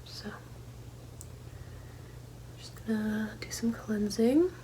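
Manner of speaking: hushed, near-whispered cleansing; ASMR style. Real, not acted. Host cleansing all around the viewer's head, ASMR style. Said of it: "A S M R style".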